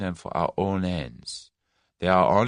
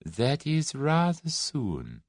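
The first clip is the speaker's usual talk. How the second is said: very slow, weighing each word; the Russian thinking it over; considering; 8 semitones higher, much slower than usual. A narrator's reading. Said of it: "The Russian seemed to consider".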